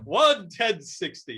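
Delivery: slow, high, loud announcement; mock proclamation. Guest echoing the host's cue, hammed-up slow proclamation. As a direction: draw it out as a grand title. Echoes the cue back into character.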